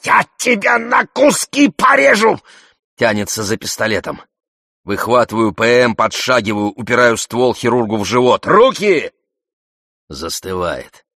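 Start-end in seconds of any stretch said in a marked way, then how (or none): 0.0-2.4 high, furious shouted threat; spitting with rage. A narrator's reading. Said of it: "брызжет слюной". 8.5-9.1 loud, high one-word order; sharp command. A narrator's reading. Armed man orders hands away.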